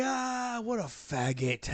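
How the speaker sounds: high-pitched jeer that drops and drags out; mock laughing father. Retelling a dad mocking his son, mock laughing jeer. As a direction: imitate a father laughing in ridicule, starting high. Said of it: "his dad just started laughing".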